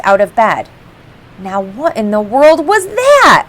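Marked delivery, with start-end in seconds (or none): 1.4-3.5 jolted awake, higher and louder; startled question. Acted. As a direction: blurt it out, woken by the splash. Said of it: "McGee said".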